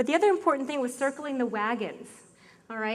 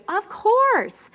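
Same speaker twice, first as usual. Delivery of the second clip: high, louder eager of course; eager reply; 10 semitones higher, 8 dB louder than usual. Retold or imitated. Upline answering her in the lunch line, eager and high. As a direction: chirp it brightly.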